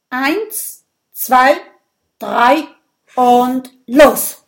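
counting off loudly to start the contest; loud countdown. Acted. Erik starting the insult duel, loud countdown. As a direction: call out the start like a referee.

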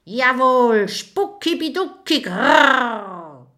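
high, emphatic spook voice ending in a growl; ghost growl. Acted. Ghost firmly confirming its words to the dragon. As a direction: insist on it like a proud ghost.

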